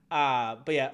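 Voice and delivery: usual speaking voice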